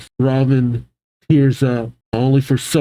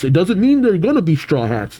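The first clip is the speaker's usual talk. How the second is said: ordinary delivery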